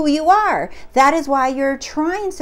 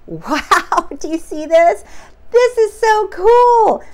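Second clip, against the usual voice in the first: much higher and louder, slow and gushing; excited; 8 semitones higher, 6 dB louder than usual. Real, not acted. Vlogger marvelling at the clip for her viewers, thrilled. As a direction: gush over it with real delight.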